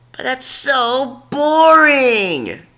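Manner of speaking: high, very slow whining protest; whiny pout. A narrator's reading. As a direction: stretch every word like a bored child. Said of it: "pouted even more".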